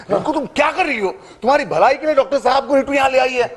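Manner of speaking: high, fast reproach; agitated scolding. Acted. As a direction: rush into scolding your wife. Husband scolds wife after threat. (झुंझलाकर तेज़ डाँट)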